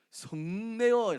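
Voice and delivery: loud, very slow, high stress on 성내어; drawn-out emphasis. Shown as a demonstration. Preacher reads the verse word with loud slow stress. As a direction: stretch 성내어 out and punch it.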